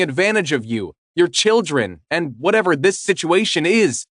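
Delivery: put-on high, agitated girlfriend; upset confrontation. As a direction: demand answers, hurt and angry.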